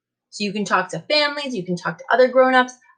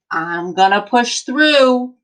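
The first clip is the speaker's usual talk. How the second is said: put-on slow, emphatic read-aloud for kids; reading to children; 2 semitones lower, 3 dB louder than usual. Teacher reading an encouraging book to her class, emphatic. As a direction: read it like a rousing picture book.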